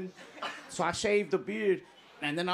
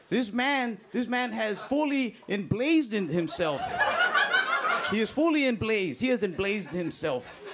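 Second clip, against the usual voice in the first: news reporter; 6 semitones higher than usual. Retold or imitated. CNN reporter describing the man on fire, dramatic report. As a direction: play a breathless TV newswoman. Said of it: "I just hear the lady go".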